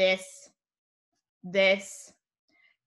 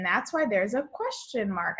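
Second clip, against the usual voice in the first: ordinary talk, nothing marked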